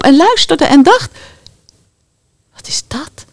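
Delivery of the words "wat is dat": whisper. Acted. Traveller wondering.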